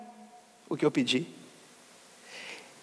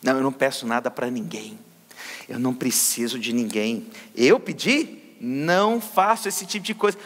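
a lower, softer, haughty put-on of a proud believer; mock arrogant; 9 semitones lower, 3 dB quieter than usual. Preacher imitating a proud believer who never prays, mock arrogant. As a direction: lightly play the self-sufficient person who asks for nothing. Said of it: "porque eu sou um arrogante".

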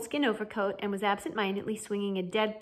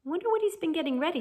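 higher, quick worried wondering; touch of nervousness, raised pitch; note of anxiety; 8 semitones higher, faster than usual. A narrator's reading. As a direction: hurry it out with slight unease. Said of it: "a note of anxiety".

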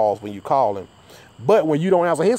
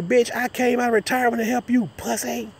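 mock indignant retort; 10 semitones higher than usual. Retelling an insult to Monty.

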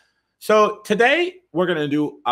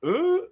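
short, very high "ooh". Real, not acted. Host reacting while showing an article on screen, high ooh. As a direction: let out a high-pitched ooh.

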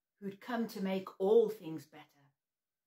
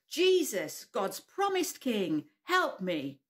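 slow louder call; children shouting louder; louder group shout; 4 dB louder, slower than usual. Shown as a demonstration. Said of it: "a little bit louder".

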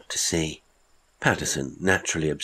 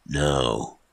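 surly no; 3 semitones lower than usual. Acted. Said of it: "in a surly tone".

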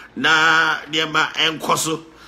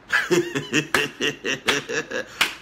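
long, soft run of chuckles; amused chuckle; 5 semitones lower, 5 dB quieter than usual. Real, not acted. Host amused by the beast facing Komoko, chuckling. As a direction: chuckle on and on, quietly. Host laughs at the fighter's downfall.

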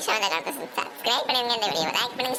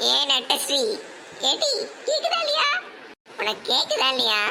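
calling out urgently; 4 semitones higher than usual. Acted. Unheard dead woman calls daughter-in-law.

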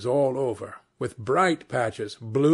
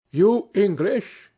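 slow, louder, beaming question; all smiles; 7 semitones higher, 5 dB louder, slower than usual. A narrator's reading. Harlequin greeting the captain from the bank, beaming. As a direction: ask hopefully, grinning. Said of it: "all smiles".

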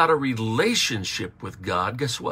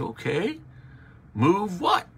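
high, puzzled question as God; mock-bewildered God asking move what; 9 semitones higher than usual. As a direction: play God baffled by the plea. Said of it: "God is saying".

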